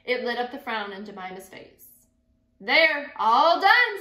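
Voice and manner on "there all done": high and loud, proud of the finished work; bright proud "all done" from Pa; pleased announcement. A narrator's reading. Pa announcing his knitting is done, loud and high.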